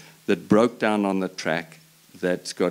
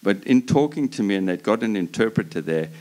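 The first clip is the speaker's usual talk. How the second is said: normal talking voice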